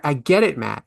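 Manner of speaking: normal talking voice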